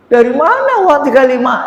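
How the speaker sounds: high anxious voice of a man short of money; worried pilgrim. Retold or imitated. Preacher voicing a worried pilgrim, high and anxious.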